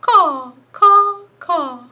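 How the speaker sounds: high loud bird calls; bird caw. Retold or imitated. Librarian cawing like a bird for children. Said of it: "a cardinal is what I found".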